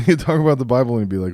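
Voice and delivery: ordinary delivery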